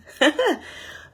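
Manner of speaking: high-pitched short giggle; short laugh. Real, not acted. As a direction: let out a quick high laugh. Laughs after talk of peace.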